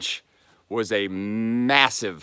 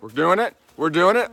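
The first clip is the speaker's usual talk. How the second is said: normal talking voice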